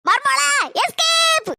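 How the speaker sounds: long, drawn-out escape yell; shouted getaway call. Acted. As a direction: call out to make a run for it. Calls daughter-in-law to flee. (நீட்டி முழங்கும் கத்தல்)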